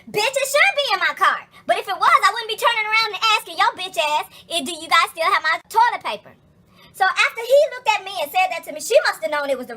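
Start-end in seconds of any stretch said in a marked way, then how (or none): 0.1-1.5 higher, louder angry retort; outraged comeback to the bagger. Retold or imitated. 1.6-8.8 louder, higher heated rant; loud, indignant venting. Real, not acted. Heated rant about the bagger.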